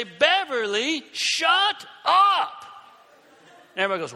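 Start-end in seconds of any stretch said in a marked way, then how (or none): 0.0-2.6 high, loud shout of shut up; yelling shut up. Retold or imitated. Turning around in class to Beverly, a loud high shout. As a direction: snap at her with a big yell. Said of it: "and I say".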